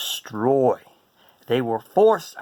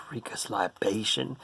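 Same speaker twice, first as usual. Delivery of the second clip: softer, unvoiced; whispered card name; 4 semitones lower, 7 dB quieter than usual. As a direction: breathe the name quietly.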